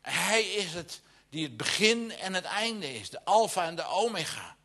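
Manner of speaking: high, ringing preacher's read, swelling into the call to sing; preaching scripture. Acted. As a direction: proclaim it from the pulpit, lifting to a slow joyful shout. Reads scripture with rising fervour.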